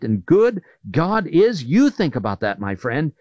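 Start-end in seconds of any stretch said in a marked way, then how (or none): none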